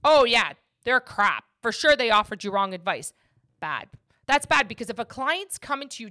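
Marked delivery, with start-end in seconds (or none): 0.0-3.1 trash-talk the competition like a smug rival; mocking rival broker. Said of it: "people in this industry that will be like".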